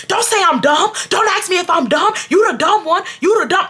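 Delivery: high-pitched indignant protest; squawky retold quarrel; mock screaming. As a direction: imitate an offended man arguing back. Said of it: "we are screaming at each other".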